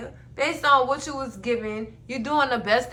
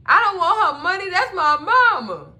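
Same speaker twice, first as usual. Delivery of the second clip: higher, louder insistent protest; 7 semitones higher, 8 dB louder than usual. Retold or imitated. Jeremiah telling Fatima he doesn't want the money, raised protest. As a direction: defend yourself, raised voice.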